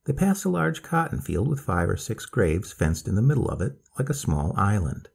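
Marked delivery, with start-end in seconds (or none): none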